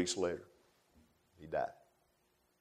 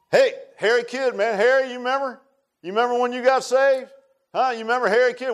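louder, higher call across the room; 10 semitones higher, 6 dB louder than usual. Real, not acted. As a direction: call to Harry in the pews. Calling out to a congregant.

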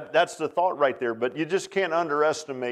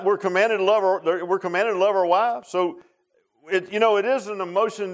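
raised preaching; 6 semitones higher than usual. Real, not acted. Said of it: "preaching builds".

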